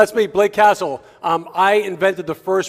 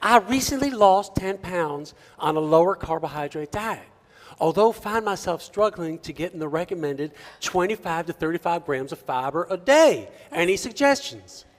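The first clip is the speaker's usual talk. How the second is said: attempted southern accent. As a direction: read it in an unconvincing southern accent. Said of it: "trying to do a southern accent".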